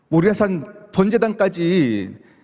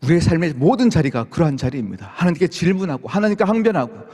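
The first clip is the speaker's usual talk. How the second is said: normal talking voice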